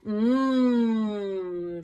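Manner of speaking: savoring hum; contented mmm. A narrator's reading. Camilla tasting the lima beans, contented hum. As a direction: hum with pleasure. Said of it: "said Camilla".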